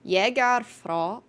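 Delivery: slow, careful Danish accent on "Jägar fra"; attempted Danish accent. Shown as a demonstration. Streamer reading Danish to chat, slow Danish accent attempt. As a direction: sound out the Danish words slowly in a shaky Danish accent. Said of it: "My Danish accent is probably not very good".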